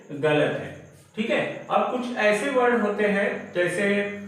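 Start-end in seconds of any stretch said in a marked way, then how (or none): none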